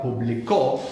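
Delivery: "publicó" as poo-blee-KO; final-syllable stress. Shown as a demonstration. Teacher shows the past form, "publicó" stressed at the end. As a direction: land hard on the last syllable of "publicó". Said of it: "appreciate the importance of the accent".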